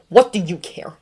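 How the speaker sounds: a touch of a sharp retort; snapped. A narrator's reading. Severus snapping back at James, understated. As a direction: bite the words off. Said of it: "Severus snapped".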